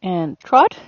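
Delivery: TROT lifted on a sharp rise; upward transition command. Shown as a demonstration. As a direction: say "trot" with the voice going up. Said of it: "the voice goes up".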